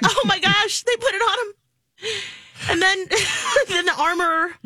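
giggling and gushing while talking; laughing. Real, not acted. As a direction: laugh through the words. Laughing while gushing over Grogu.

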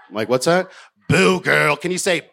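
a lightly voiced plastic Hollywood host; hammed-up perky host; fake host voice. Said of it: "her voice sounded like there was plastic surgery in her throat".

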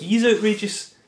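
amused disbelief. Real, not acted. Incredulous about Nick Cage's acting, high and loud. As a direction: squeal it in disbelief.

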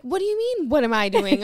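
loud, mock-incredulous comeback; hammed-up affronted retort; retold indignation. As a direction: throw the question back, exaggerated. Acted indignant reply to partner.

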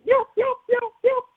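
very high squeaky hen yelps; turkey yelp call. Shown as a demonstration. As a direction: mimic a turkey hen's piercing yelp. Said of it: "I paused for one second and went".

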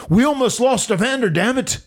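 high-pitched retold outburst; Vince. Boss scolding John over nearly losing Evander, high-pitched imitation. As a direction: imitate the boss's alarm, pitched well up. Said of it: "Vince said".

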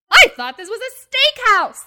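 high, very loud outraged shout; mock a customer yelling in outrage; imitated angry customer's yell. Restaurant worker imitates an outraged walk-in customer, yelling. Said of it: "yell at a stranger".

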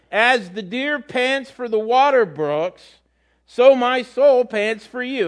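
scripture reading. Acted. Preacher to congregation, slow reverent psalm. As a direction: lift the verse up slowly.

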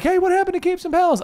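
high, eager fan voice; commenter voice. Pretend. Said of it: "Is that your comments voice?".

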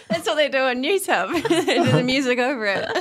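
laughing through the words; giggling while she talks. Real, not acted. Laughing at the memory.

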